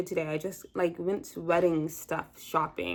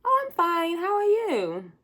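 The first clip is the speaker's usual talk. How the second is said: much higher, bright fake fine; high sing-song forced cheer; fake cheerful; 12 semitones higher, 5 dB louder, slower than usual. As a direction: paste on a cheery lie. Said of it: "go around and say".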